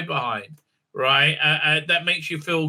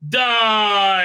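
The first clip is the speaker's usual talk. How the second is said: loud, raised, drawn-out battle cry; mock killing cry; 4 semitones higher, 6 dB louder than usual. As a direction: cry it out like a hammed-up executioner. Acting out killer slapping a throat.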